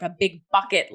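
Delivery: usual speaking voice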